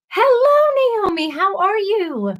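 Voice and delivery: excited hello. Real, not acted. Greeting the arriving viewer.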